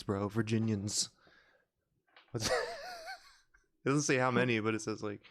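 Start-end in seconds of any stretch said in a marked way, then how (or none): 2.6-3.1 squeaky laugh. Real, not acted. Laughing at own rambling.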